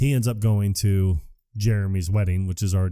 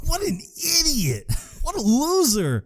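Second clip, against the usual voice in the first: high-pitched, drawn-out mock gloating; gleeful mockery. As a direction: jeer like you're delighted by his bad luck. Gloating over friend's marriage news.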